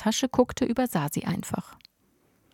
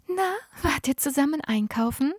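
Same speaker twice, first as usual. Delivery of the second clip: sugary neighbour; 7 semitones higher than usual. A narrator's reading. Neighbour greets the boys.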